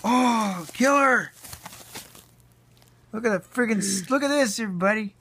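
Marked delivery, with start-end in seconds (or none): none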